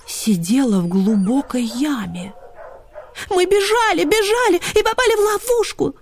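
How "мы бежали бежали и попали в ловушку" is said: high, upset chattering hounds; clamouring greyhounds. A narrator's reading. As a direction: clamour together in alarm. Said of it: "загалдели борзые". (высокий взволнованный гомон)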